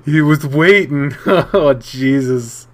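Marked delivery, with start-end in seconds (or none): none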